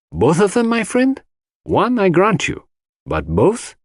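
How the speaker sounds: lightly voiced Belgian detective doubting; Poirot's voice.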